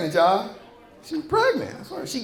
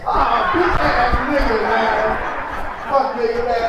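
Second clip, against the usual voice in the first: slow, drawn-out indignant groan; mock outrage; 3 semitones higher, slower than usual. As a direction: drag it out like you've been betrayed. Mock outrage at kid's answer.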